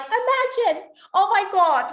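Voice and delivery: high squeal of amazement, then a gasp; shocked disbelief. Retold or imitated. Retelling a friend's story, squealing in shock. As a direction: squeal it like you can't believe it. Vlogger voicing her own disbelief.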